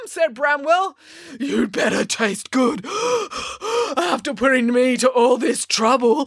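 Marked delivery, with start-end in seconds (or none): 1.4-6.2 panting giant, heavy gasps between phrases; winded giant call. A narrator's reading. Giant grumbling at his fleeing dinner, panting heavily. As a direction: puff it out, worn out from running. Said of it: "called the giant".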